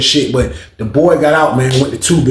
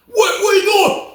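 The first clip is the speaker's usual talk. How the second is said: high, drawn-out shout; alarmed yell; slower than usual. Retold or imitated. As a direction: call out in shock and alarm. Kurt yells at the attacker.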